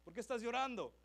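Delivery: high, loud street friends calling out. Retold or imitated. Boys on the street call to a crying friend, loud and high. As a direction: yell over like a buddy on the corner. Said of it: "caminando y like".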